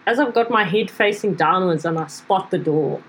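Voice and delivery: usual speaking voice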